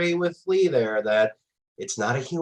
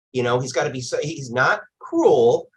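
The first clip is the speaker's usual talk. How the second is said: usual speaking voice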